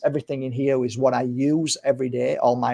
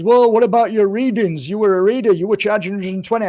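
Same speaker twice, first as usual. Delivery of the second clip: mock interviewer; 7 semitones higher, 5 dB louder than usual. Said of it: "and then he says".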